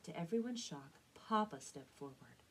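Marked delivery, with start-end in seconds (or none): none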